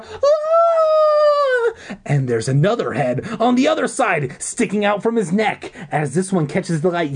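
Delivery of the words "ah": very high, loud squeal of terror; terrified squeal. A narrator's reading. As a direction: shriek in terror. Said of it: "Abby squealing in terror".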